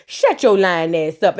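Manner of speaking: mock snap. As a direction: bite off each word at him. Rashida snapping, voiced.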